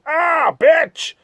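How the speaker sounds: high yelp of pain as the blade hits; pained cry. Acted. Fanny slashed by Orlando's knife, high pained yelp. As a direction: shriek it like you've just been cut.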